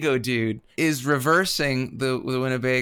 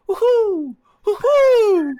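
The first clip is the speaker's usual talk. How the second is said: high woohoo; 7 dB louder, much slower than usual. Retold or imitated. Mimicking a character at the lake.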